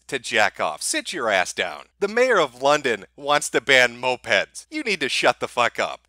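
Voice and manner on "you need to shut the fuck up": ordinary delivery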